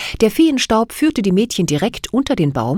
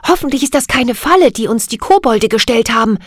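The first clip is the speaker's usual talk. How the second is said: usual speaking voice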